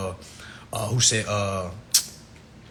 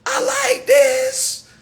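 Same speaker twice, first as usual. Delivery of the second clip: high, slow, stretched shout; mock shout; 3 dB louder, much slower than usual. Comic hosting a bit, shouting his catchphrase. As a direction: belt it out like a hype catchphrase.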